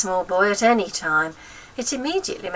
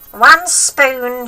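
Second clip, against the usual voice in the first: put-on high, loud cranky old woman; bossy wicked granny, pitched up and loud; grandma voice; 6 semitones higher, 11 dB louder than usual. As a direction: nag the boy like a spiteful old grandma.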